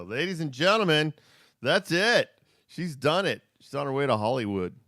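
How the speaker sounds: high, loud showbiz announcer; hammed-up proud announcer voice; mock announcer. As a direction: announce a star to the crowd. Mock announcer hailing child's performance.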